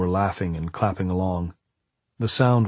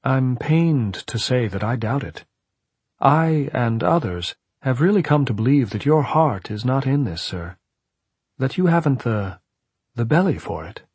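higher, politely pained tone; well-bred regret; 5 semitones higher than usual. A narrator's reading. Caretaker doubts the man's resolve, with well-bred regret. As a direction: regretfully voice your doubts, courteously. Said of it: "replaced by well-bred regret".

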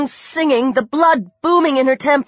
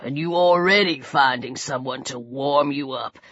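deep, soft, taunting voice; hushed, insinuating thug; menacing attacker; 8 semitones lower, 5 dB quieter than usual. A narrator's reading. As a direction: needle the victim low and unhurried. Attacker taunts and threatens victim.

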